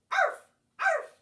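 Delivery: high, loud yappy barks; dog barking. Acted. Teacher barking as the dog in the song. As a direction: bark like a little dog. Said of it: "and the dog said".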